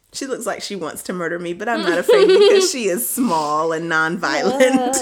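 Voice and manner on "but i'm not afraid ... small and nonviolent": louder, breaking into laughter; laughing tease. Real, not acted. Host teasing an annoyed wife, laughing. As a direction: tease her through a laugh.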